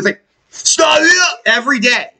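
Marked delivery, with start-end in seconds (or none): 0.5-1.4 very high rock-frontman yelp; mock rock-singer cry. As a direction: launch into a hammed-up stage holler. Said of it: "he wakes up on stage".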